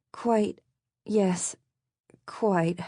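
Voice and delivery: awkwardly mimicked British accent; stilted British accent. A narrator's reading. Maddie answers the scolding Brit, stilted accidental British accent. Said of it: "Maddie's words sounded stilted".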